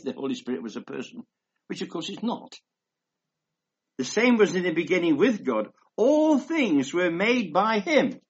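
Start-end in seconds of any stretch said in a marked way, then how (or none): 4.0-8.2 measured pulpit reading voice; scripture reading. Acted. Reads scripture aloud.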